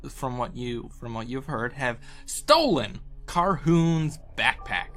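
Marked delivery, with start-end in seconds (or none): none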